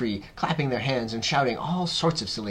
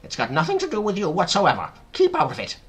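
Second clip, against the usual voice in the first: high, loud scolding; Aunt Sponge declaring; 8 semitones higher, 6 dB louder, faster than usual. A narrator's reading. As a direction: tell the child off emphatically. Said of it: "Aunt Sponge declared".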